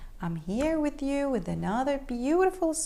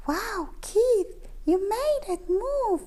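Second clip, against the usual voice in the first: high, amazed cats; amazed gasp; 7 semitones higher than usual. A narrator's reading. Cats amazed Keith made the wand move. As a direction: sound stunned by the trick. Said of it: "they gasped".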